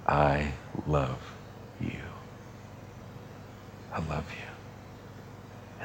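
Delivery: very soft, slow, breathy whisper of love; tender whisper. Pretend. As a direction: hush it like a loving secret. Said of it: "Jesus is always looking at me and saying".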